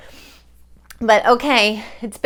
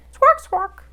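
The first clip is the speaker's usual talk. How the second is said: much higher, loud squawk; bird squawk; 11 semitones higher, 7 dB louder than usual. Pretend. Host squawks like a hidden bird. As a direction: squawk like a parrot.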